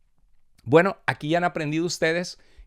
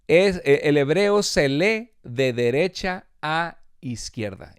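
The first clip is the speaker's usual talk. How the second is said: slow demo reading; 3 dB louder, slower than usual. Shown as a demonstration. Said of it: "Pero si lo lees al revés, dice".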